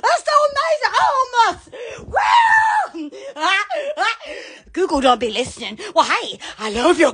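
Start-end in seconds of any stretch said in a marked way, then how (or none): 2.1-3.1 loud, high, drawn-out woo; excited falsetto-high holler; high whoop. Real, not acted. Excited whooping at good news.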